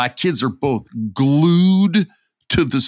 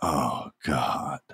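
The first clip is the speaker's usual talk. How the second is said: dismayed murmur; 9 semitones lower, 8 dB quieter than usual. Retold or imitated. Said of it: "looking at him like".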